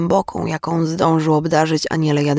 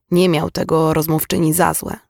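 ordinary delivery

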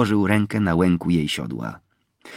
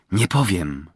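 ordinary delivery, nothing marked

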